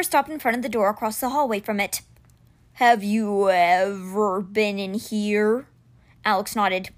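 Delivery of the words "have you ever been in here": growling beast. Acted. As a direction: growl it out slowly like a huge winged cat. Simber the stone beast speaks.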